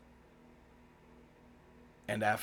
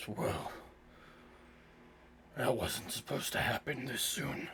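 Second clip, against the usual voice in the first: slightly deeper, raspy creature voice; 3 semitones higher than usual. Acted. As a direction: rasp it out a bit lower, as the beast. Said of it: "deeper, kinda raspy voice".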